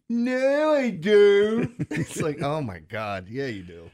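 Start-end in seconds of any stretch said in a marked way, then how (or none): 0.1-1.7 put-on much higher, louder, thick Baltimore accent; mock Baltimore accent. Father imitating son, hammed-up Baltimore accent. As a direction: belt it like a true Baltimorean. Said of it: "that terrible accent".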